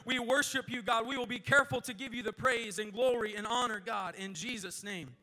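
loud, pleading prayer over the congregation; the preacher prays loudly for anointing on the service; fervent prayer. Acted. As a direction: call on God with full force. Prays aloud before sermon.

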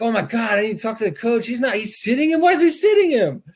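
high, frantic, rising to a squeal; mock panicked parent. Mother mocking her own panic over her son being benched, frantic squeal. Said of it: "I'm making fun of myself there".

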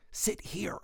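hushed church-pew whisper. Acted. Directing a latecomer to a seat in church, whispered. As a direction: whisper it discreetly, without voice.

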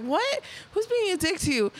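high surprised what, who's being a dick; retold shocked host; incredulous what. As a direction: jump up in pitch, mock alarmed. Acting concerned host at party.

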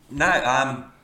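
laughing at himself. Real, not acted. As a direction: crack up mid-sentence.

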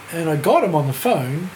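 normal talking voice

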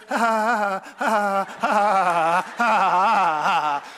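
loud, mechanical ha ha ha; mock canned laugh. Studio man on the laugh track, loud mock laughter. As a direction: pump out a fake studio laugh. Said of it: "somebody in the studio goes".

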